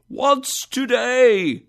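loud, high call down. A narrator's reading. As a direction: call down to the street. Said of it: "cried Scrooge, calling down".

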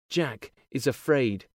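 ordinary delivery, nothing marked

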